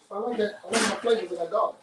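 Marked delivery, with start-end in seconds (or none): none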